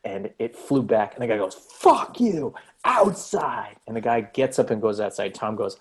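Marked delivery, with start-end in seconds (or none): 1.5-3.8 high, loud furious challenge; angry yell. Retold or imitated. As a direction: bark it like a drunk picking a fight. Guy throws beer, challenges.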